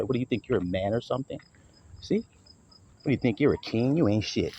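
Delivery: low, quiet sneer at the husband; mocking taunt. Wife taunting her husband at the get-together, quiet mocking sneer. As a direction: belittle him under your breath, mocking.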